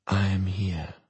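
soft calm voice; quiet reassurance in her head. Acted. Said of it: "soft and silent, cool as water".

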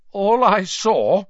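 loud, slow and stubborn. A narrator's reading. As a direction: dig in and refuse to budge. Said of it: "said Ernie stubbornly".

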